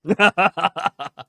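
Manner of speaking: high, very loud burst of laughter. Real, not acted. Host reacts to co-host's joke about his age, laughing.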